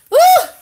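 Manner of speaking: high, loud woo; joyful whoop. Real, not acted. Thanking God for love, an exuberant whoop.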